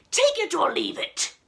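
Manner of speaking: high, loud ultimatum; angry archduke shout. Acted. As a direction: bark it as a final demand. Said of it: "shouted the Archduke".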